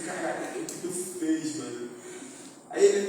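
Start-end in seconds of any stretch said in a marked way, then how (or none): 0.1-1.9 dismayed hush. Retold or imitated. Retold dismay at barber.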